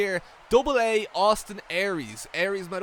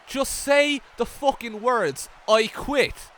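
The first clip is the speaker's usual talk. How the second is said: higher, drawn-out angry order; slow, furious demand; 4 semitones higher, 4 dB louder, much slower than usual. Acted. Wrestler demands his opponent say the words, slow and furious. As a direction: spit out each word slowly in rage.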